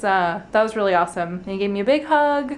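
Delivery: usual speaking voice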